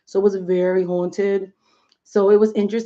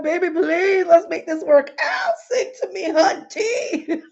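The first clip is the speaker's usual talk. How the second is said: high, loud, begging and swooning; pleading admirer; 8 semitones higher, 4 dB louder than usual. Retold or imitated. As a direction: beg him dramatically to sing. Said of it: "I'd be like".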